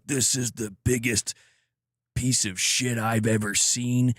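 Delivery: slow, drawn-out movie-star impression of a disgusted critic; Jack Nicholson. Streamer mocking harsh game critics, accidental Nicholson impression. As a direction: stretch the words out with a star's cadence. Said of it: "why do I sound like Jack Nicholson".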